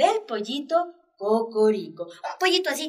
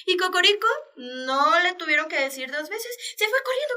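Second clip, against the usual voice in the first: ordinary delivery, nothing marked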